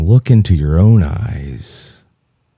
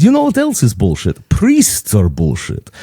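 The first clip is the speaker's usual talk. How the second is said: put-on Greek accent; 3 semitones higher than usual. Father-in-law declares on priests, Greek accent. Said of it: "my best Greek impression".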